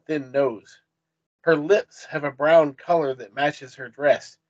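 ordinary delivery

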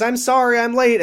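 high mock breathless apology. Retelling Grace rushing in late, high mock apology. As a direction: gush the excuse. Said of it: "she says".

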